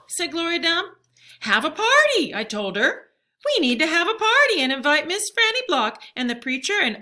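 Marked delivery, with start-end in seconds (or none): 1.4-2.3 high, loud burst of excitement; a girl bursting with a plan; excited idea. A narrator's reading. Excited girl proposes party idea. 3.5-7.0 high and loud, rushing with excitement; excited girl listing guests; eager planning. A narrator's reading. Girl excitedly plans the party.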